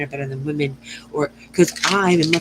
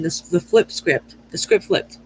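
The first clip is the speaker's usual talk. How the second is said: ordinary talk, nothing marked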